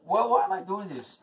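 ordinary delivery

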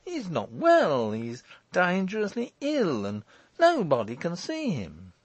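high, insistent protest; dogged. A narrator's reading. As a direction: stubbornly insist on the excuse. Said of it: "said Morris doggedly".

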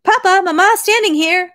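put-on high, loud little boy calling out; child's shout. A narrator's reading. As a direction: shout it through the door like a small boy. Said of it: "Andrushka shouted something through the door".

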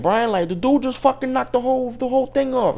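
high, loud mock complaint; retold flustered protest. Retold worker protesting to boss.

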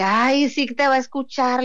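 mocking. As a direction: laugh at her faith with open scorn. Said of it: "le dije, así me reí".

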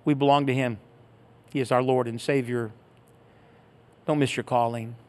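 normal talking voice

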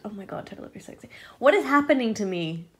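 ordinary talk

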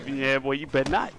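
normal talking voice

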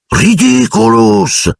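high, long-held cry; cried-out spell. A narrator's reading. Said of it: "gridò Parvati". (incantesimo gridato, lungo e acuto)

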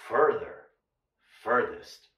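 "Further" and "Furthest" low, the UR sound drawn out, falling; teacher's low falling model of "Further"; careful model pronunciation. Shown as a demonstration. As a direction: spell out "Further", "Furthest" by ear. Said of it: "U R".

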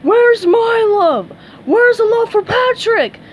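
high, full-force anguished shout; loud cartoon roar of self-pity; bellowing. A narrator's reading. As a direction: bellow it out, wild with hurt. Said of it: "Patrick bellowed".

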